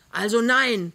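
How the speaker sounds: loud bellowed also nein; roaring. A narrator's reading. Neighbour outside yelling at Pinneberg, loud roar. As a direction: bellow it through the closed door. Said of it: "brüllt Krümner draußen".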